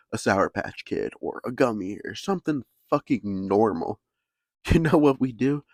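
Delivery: normal talking voice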